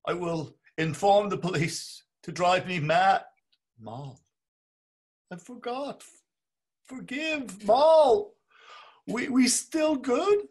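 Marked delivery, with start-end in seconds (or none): 3.8-4.2 quiet murmur. Acted. Bloom calls for his wife. 5.3-10.5 whimpering plea. Acted. Bloom begs, Bella mocks his crying.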